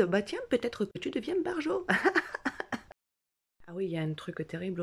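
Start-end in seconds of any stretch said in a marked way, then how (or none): 0.0-1.9 high, laughing mock doctor; doctors' voice. Doctors telling the patient she may be going nuts, mock doctor voice. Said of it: "les médecins te disent". 1.9-2.8 high-pitched laugh. Real, not acted. Performer laughing at the doctors' verdict, high laugh.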